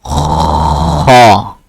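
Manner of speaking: ha said low, pressed from the throat; throaty ha. Shown as a demonstration. Teacher modelling the throat ha again. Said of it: "This one?".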